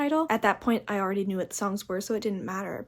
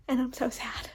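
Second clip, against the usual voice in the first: whispered sadness; 2 semitones higher, 3 dB quieter than usual. Real, not acted. After spoiler.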